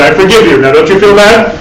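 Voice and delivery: hammed-up guilt-trip forgiveness; mock smug forgiveness. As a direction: forgive them so they feel guilty. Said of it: "using forgiveness to punish".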